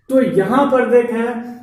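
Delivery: ordinary delivery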